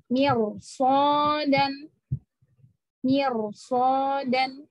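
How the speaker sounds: very slow "Mirsodan" with a thick ro; heavy ro. Shown as a demonstration. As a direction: recite "Mirsodan" drawn out, ro full. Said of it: "Maka baca ro-nya tebal".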